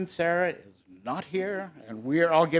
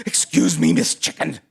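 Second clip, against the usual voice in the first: lower, louder pig character; a hint of a gruff farm animal; lightly voiced pig; 8 semitones lower, 6 dB louder than usual. Pig politely asks Miss Chicken, lightly put-on lower voice. Pig addresses hen in story.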